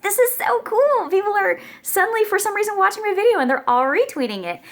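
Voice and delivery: telling her husband the news, thrilled; excited. Retold or imitated. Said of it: "I was like".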